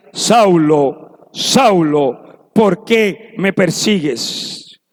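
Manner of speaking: deep, slow voice from heaven calling; deep heavenly voice calling Saulo by name; voice of Jesus from heaven. Acted. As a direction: call down low and slow, like a voice from above.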